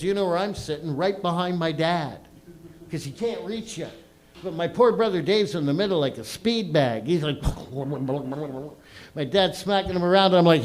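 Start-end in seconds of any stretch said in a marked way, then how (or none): none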